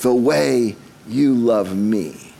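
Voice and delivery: slow, drawn-out recital of a chorus; chorus quoted. Retold or imitated. Preacher quoting a love song's chorus, slow and drawn out. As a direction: linger on LOVE, pausing before the rest. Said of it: "he gets to the chorus".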